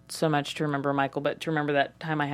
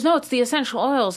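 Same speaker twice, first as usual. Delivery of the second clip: sister's voice; 6 semitones higher than usual. Retold or imitated. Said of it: "she goes".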